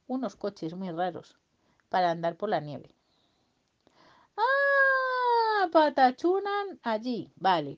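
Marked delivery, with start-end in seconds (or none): 4.4-7.8 high burst then slow, loud; light-bulb moment; sudden realisation. Acted. Boy finally understanding the word, surprised and slow. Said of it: "realising what it means".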